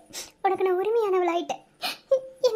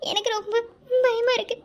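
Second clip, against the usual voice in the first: usual speaking voice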